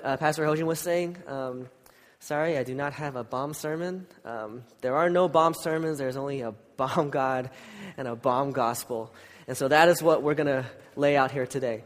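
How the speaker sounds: ordinary delivery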